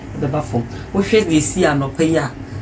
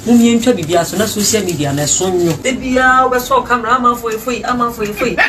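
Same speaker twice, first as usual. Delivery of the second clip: higher, louder urgent scolding; heated plea; 7 semitones higher, 5 dB louder than usual. Real, not acted. Elder scolding the quarrelling couple about social media, heated and loud. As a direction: press the point hard, rising heat.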